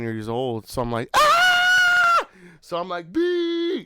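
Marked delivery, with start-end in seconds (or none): 1.1-2.2 very high, loud scream; imitated child's scream. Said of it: "So I scream out". 3.1-3.8 high-pitched mock cry of alarm; imitated panicked scream. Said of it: "So I scream out".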